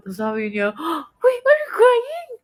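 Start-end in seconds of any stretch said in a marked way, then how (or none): 1.2-2.3 high, drawn-out astonished question; startled concern. Retold or imitated. Friend noticing the speaker is tearing up, startled. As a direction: imitate a friend's squeal of surprise.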